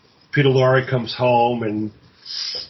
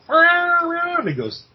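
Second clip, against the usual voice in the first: long, very high, drawn-out cat wail; imitated cat yowl; 4 dB louder, much slower than usual. Cat yowling from behind the wall, long high wail.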